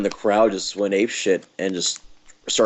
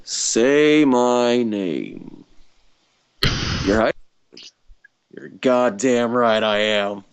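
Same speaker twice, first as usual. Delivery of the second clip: menacing slow drawl; much slower than usual. Acted. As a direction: deliver it slow and threatening. Quoting a famous TV line.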